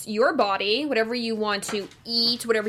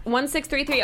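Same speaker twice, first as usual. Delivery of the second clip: normal talking voice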